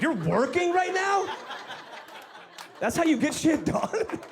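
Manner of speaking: high incredulous put-on voice; sneering mock disbelief. Mocking reply, high put-on voice.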